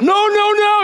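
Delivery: mock defensive squeal of no; whiny denial. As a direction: squeal a childish no.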